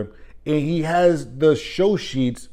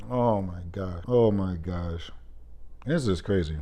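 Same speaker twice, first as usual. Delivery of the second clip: much lower and softer, deflated; weary disbelief; 8 semitones lower, 8 dB quieter than usual. Real, not acted. Vlogger giving up on the agent, lowered and tired. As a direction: let it sink out of you. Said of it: "exasperated aside".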